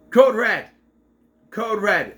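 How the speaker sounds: loud urgent alarm call; mock radio alarm. Charlotte calling over the radio, loud and urgent. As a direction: shout it down the radio like an alert.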